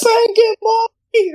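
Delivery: shrill falsetto fan scream; squeaky high mock scream. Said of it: "Did you hear the guy in the audience, like, scream".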